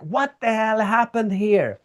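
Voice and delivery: charged-up disbelief; energized. Retold or imitated. Artist facing broken glass, a slow energized demand. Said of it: "she got so much energy".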